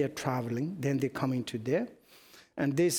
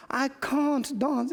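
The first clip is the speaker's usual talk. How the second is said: mock protest; 11 semitones higher, slower than usual. Himself refusing to dance.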